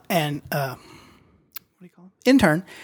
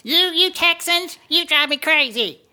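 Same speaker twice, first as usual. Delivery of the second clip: high put-on voice of an annoyed caller; cranky caller voice; 7 semitones higher than usual. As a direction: play a grumpy listener who hates everything. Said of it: "This is the call from Jesus Geek".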